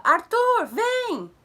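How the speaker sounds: high, very loud shout across a corridor; calling a child. Retold or imitated. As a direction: yell for the boy to come. Acts aunt calling child. (chamando o menino aos gritos)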